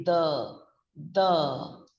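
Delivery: THE with tongue tip between the teeth; TH sound. Shown as a demonstration. Teacher demonstrates the T H sound, isolated THE. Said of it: "puts the tip of the tongue in between your teeth".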